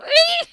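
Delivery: high sharp imitated cat squeal; high, loud yelp of a hurt cat. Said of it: "I heard the cat go".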